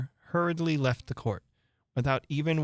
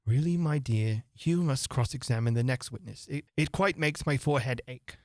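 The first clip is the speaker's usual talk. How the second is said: usual speaking voice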